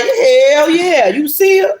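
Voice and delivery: high-pitched, hyped-up lover's brag; excited boasting. Acted. Reader voicing Emperor showing off his woman, excited boasting. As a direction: crow it high, showing her off.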